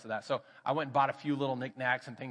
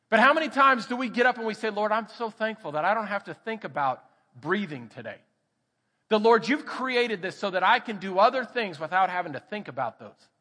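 loud, higher preacher's cry; impassioned sermon delivery; fervent preaching; 7 semitones higher, 7 dB louder than usual. Acted. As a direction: preach it out to the congregation. Preaching at full force.

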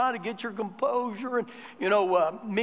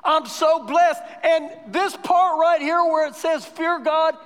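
higher, louder sermon at full pulpit force; fervent preaching; 7 semitones higher, 4 dB louder than usual. Acted. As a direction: preach it out, building conviction. Preaching with rising fervour.

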